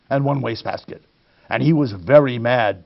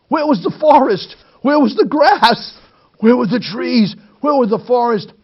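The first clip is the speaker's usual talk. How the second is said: startled surprise; 8 semitones higher, 3 dB louder than usual. A narrator's reading. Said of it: "They suddenly popped wide apart".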